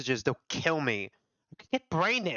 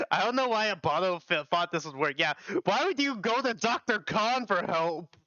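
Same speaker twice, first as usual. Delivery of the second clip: ordinary talk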